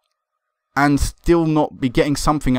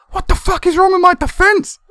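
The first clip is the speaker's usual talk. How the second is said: much higher, shrill-pitched curse at the defense; exasperated outburst; 4 dB louder than usual. Real, not acted. Manager raging at his own defense, exasperated. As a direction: vent full frustration.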